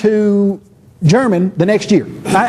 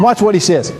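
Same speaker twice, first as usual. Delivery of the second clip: ordinary delivery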